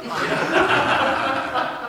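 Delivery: soft, airy laugh under his breath; breathy laugh. Real, not acted. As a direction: let a quiet laugh escape. Laughs at his own joke.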